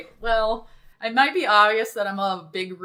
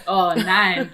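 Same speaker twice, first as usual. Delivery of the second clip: loud mock German accent; hammed-up German-accented dismay; 8 dB louder than usual. Shown as a demonstration. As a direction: cry out in a put-on German accent. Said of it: "I do the German accent much better".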